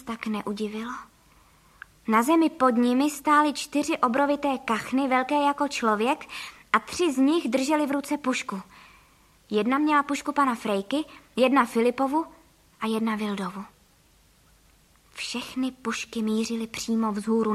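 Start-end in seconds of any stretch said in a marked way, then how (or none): none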